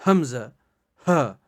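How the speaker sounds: حمزہ spoken loud and crisp from the back of the throat; throat letter demo. Shown as a demonstration. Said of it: "آپ بھی try کریں بار بار". (حمزہ کو حلق کے آخری حصے سے واضح ادا کریں)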